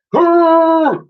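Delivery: high, loud trumpeting cheer; mammoth hoot. Acted. Mammoth cheering on the ramp, loud hoot. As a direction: hoot it like a happy beast. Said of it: "hooted the mammoth".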